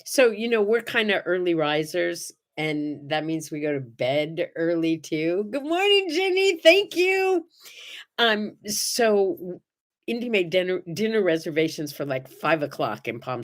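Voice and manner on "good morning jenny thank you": lifted high, cheery hello to a viewer; pitched-up welcome to a new viewer; bright greeting. Real, not acted. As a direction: greet her warmly and brightly. Greeting viewers joining live.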